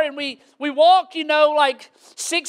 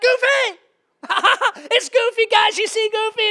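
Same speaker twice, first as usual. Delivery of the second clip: high, laughing, starstruck squeal; giddy; 7 semitones higher than usual. Acted. Dad spotting Goofy with his boys, giddy. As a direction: call out high with a giddy giggle. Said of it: "I'm all giddy like a little schoolboy".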